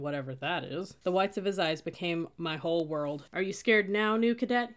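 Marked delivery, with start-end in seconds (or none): none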